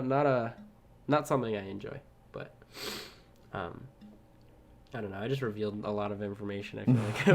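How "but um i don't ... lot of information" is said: ordinary talk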